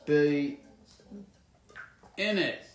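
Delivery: halting word by word, long gaps; writing aloud. Real, not acted. Signer finishing a written quote, slow and word by word. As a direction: stretch each word while writing it down.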